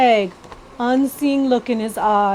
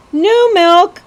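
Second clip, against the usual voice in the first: much higher, louder street cry; calling out wares; 10 semitones higher, 9 dB louder than usual. Acted. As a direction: sell milk to the passing crowd. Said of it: "the cow lady called out".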